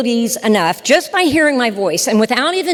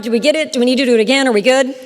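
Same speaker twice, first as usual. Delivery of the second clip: ordinary talk, nothing marked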